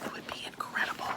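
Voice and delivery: breathless whispered awe; hushed excited whisper. Real, not acted. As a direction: whisper it like it's too good to say aloud.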